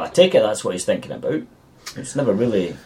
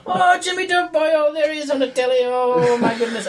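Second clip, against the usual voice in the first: much higher, hammed-up Irish lilt; squeaky mock Irish excitement; mock Irish villain; 14 semitones higher than usual. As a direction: gush like a stage Irishman spotting his hero. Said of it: "nutters like Tommy Lee Jones".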